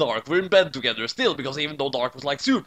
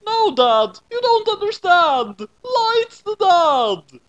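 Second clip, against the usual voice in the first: very high, loud, slowed protest; insistent correction; 8 dB louder, much slower than usual. Acted. Kid correcting angry dad about who's the father, high and loud. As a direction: shout it over the angry dad.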